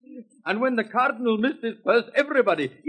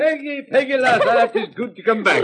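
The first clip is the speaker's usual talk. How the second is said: laughing greeting; 4 semitones higher, 5 dB louder than usual. Acted.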